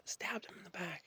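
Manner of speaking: whispered, unvoiced; hushed whisper. Real, not acted. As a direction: drop to a grim whisper. Telling of the stabbing.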